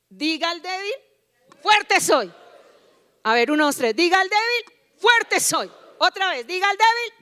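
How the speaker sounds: high, loud call-and-response leading; rallying call. Real, not acted. Preacher has church tell the weak "I am strong", rallying. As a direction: rouse the crowd to declare strength. Leads congregation in a strength chant.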